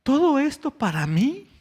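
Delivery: mildly acted wonder, lifting into a question; amazed child. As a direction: lightly voice an astonished little boy asking. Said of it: "abrió los ojos, vio a mi esposa y le dijo".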